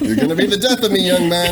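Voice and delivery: exasperated. Acted. Bartender exasperated at customer.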